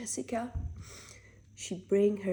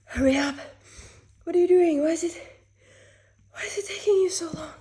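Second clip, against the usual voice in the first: higher, louder, impatient begging; urgent pleading; 7 semitones higher, 7 dB louder, faster than usual. Real, not acted. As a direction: plead faster and faster. Desperate for a tissue.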